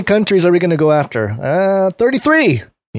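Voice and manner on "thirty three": high mock-dramatic reveal. Real, not acted. Punchline number said high.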